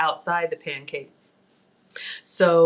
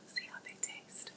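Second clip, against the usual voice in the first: hushed whisper while tasting; 20 dB quieter than usual. Real, not acted. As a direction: drop to a whisper. Tasting fruit, drops to whisper.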